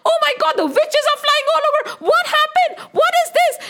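high-pitched, frantic shouts of alarm; hammed-up hysterical terror; panicked cries. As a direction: scream warnings to a fleeing crowd. Townspeople flee from ghosts and goblins.

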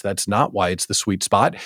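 ordinary delivery

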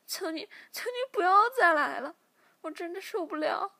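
high, loud anguished pleading; begging for mercy. Acted. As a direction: cry out begging her torturer to stop. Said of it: "小柔马上求饶起来".

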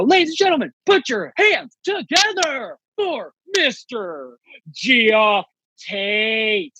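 booming high announcer voice, stretched out; big club-emcee introduction; emcee intro. Retold or imitated. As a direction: hype up the headliner like a ring announcer. Announcing comic on stage.

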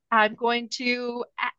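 normal talking voice

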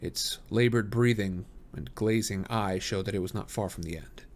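plain reading voice

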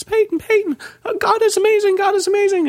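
very high, frantic praise, imitated; high-pitched mock hysterics; hysterical mom. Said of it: "she's hysterical now".

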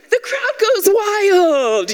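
high, loud, drawn-out cheer; crowd goes wild. Shown as a demonstration. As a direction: stretch it out like a hype announcer. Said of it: "or I'll say".

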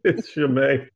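real laughter breaking into the explanation; laughing. Real, not acted. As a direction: explain loudly through your laughter. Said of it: "we're just laughing".

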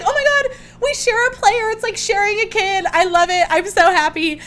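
high, loud excited squeal; thrilled squeal. Real, not acted. Host telling listeners about sharing a player, high thrilled squeal. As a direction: squeal it like the best news ever.

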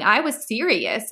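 ordinary delivery

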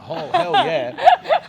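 loud, high, drawn-out cheer with a laugh; laughing, over-the-top approval; retold delight. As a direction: whoop it slowly, relishing the memory. Retelling his delighted reaction to girls.

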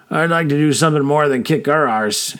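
plain reading voice

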